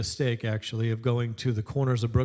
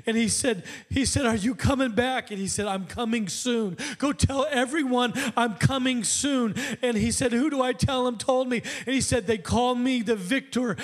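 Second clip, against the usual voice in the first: raised, higher-pitched sermon storytelling; fervent preaching; 9 semitones higher than usual. Real, not acted. Preacher telling the church of a man's dream, fervent preaching. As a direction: proclaim the dream with rising fervour.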